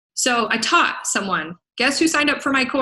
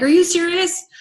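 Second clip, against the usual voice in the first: mock disbelief; 7 semitones higher, 5 dB louder than usual. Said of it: "They're like".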